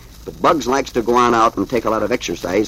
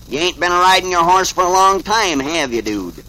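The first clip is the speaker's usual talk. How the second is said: lightly voiced, higher-pitched cowboy; old cowboy; 6 semitones higher, 3 dB louder than usual. A narrator's reading. Old cowboy questions the rider, lightly acted drawling role. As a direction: start high like a cowboy, drop on the tag question. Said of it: "here comes an old cowboy".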